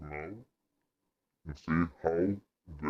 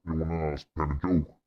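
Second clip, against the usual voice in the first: ordinary delivery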